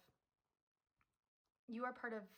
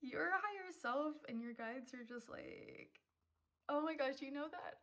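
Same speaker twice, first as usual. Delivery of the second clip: still emotional; 6 semitones higher, 5 dB louder than usual. Real, not acted. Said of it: "stop getting emotional".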